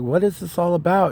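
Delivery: high-pitched retold bewildered question; mildly incredulous quote; puzzled demand. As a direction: act out asking for an explanation, voice lifted high. Retelling his question to Iris.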